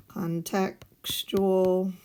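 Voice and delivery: Contextual drawn out very slowly, syllable by syllable; teacher slowing down to stress Contextual; slow, stretched-out word. Shown as a demonstration. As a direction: spell Contextual out slowly for the class.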